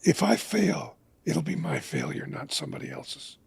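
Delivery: soft, quiet words through tears, fading almost to nothing; tearful retelling. Retold or imitated. Father to silent daughter in the car, tearful and hushed. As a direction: let it crack and sink as you confess failing her. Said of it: "and I just start to weep".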